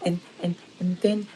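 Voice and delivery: a light imitation of a stuttering child; imitating stuttering kid. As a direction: act out the stammering boy. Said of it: "he's just like".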